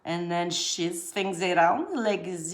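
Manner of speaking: slow, drawn-out comic voice; playful mimicry of an ad voice, stretched endings; silly commercial voice. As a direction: draw it out in a goofy put-on voice. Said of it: "I won't do that voice anymore".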